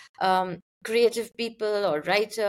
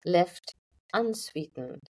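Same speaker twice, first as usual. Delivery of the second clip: poetry reading; 5 semitones lower than usual. Acted. Poet reads the title poem for podcast listeners, measured poetry reading. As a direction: read the poem out line by line, unhurried.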